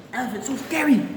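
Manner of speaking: normal talking voice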